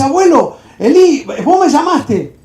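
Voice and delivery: high, loud call to wake someone. Acted. Boy wakes his grandfather at night, calling out. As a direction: call out eagerly, drawing out the name.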